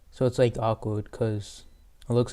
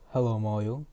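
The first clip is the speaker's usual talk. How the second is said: a slightly deeper mock greeting voice. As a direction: greet in a lightly lowered voice. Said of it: "deep voice".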